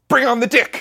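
high, loud gleeful cry; excited shout. Retold or imitated. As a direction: shout it with glee. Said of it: "we said".

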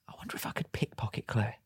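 hushed, whispered thought; sneaky voiceless murmur to himself; furtive whisper. Retold or imitated. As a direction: whisper it like a secret scheme. His sneaky thought, retold as secret.

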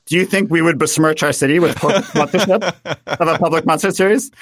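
hammed-up haughty Viennese host; condescending. Viennese host mocking American corporate concerts, condescending. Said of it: "They're just so dripping with condescension".

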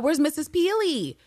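high-pitched, exasperated question; indignant demand. Real, not acted. Fan demanding to know where a missing character was, indignant.

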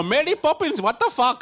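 high, laughing mock Indian accent. Comedian imagines casting directors hearing his Indian Mary Poppins in a mock Indian accent. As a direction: toss off a jokey Indian voice. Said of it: "I even offered them Indian".

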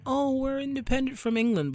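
high-pitched mock claim; mocking boast. Mocking Americans who boast of independence, high and scornful.

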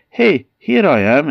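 high, loud eager cry; greedy boy's excited call; eager child call. Acted. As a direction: pop out eagerly calling.

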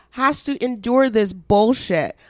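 ordinary talk, nothing marked

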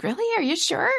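high, doubtful questioning; incredulous doubt. Retold or imitated. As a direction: question it in high-pitched disbelief. Doubting others' reassurance, acted self-quote.

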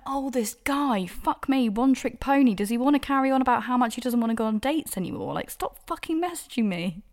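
retold outburst of sheer exasperation; exasperated rant. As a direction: let the exasperation build over the line. Ranting reaction to date's message.

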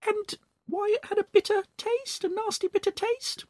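lie sweetly like a scheming wife; Missus Twit. Acted. Missus Twit fooling her husband at lunch, high sly voice. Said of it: "she said".

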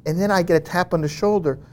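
normal talking voice

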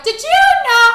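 very high, loud mock shriek; piercingly high, blaring impression; Goots. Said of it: "Goots comes in right away".

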